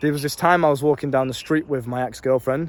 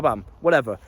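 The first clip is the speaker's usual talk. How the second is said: ordinary talk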